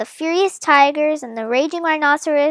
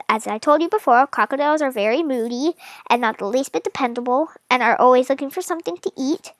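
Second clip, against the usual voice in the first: usual speaking voice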